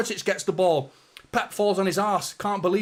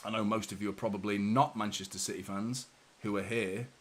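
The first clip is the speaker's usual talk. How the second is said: normal talking voice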